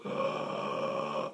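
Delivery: unvoiced inward gasp; silent gasp. Shown as a demonstration. Teacher showing the Mueller maneuver. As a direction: suck air in silently. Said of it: "If you go".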